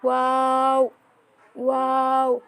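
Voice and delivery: ওয়াও with lips rounded at start and end; Arabic letter demo. Shown as a demonstration. Teacher showing students how to say ওয়াও. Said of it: "শুরুতে ঠোঁট গোল করতে হবে এবং শেষে".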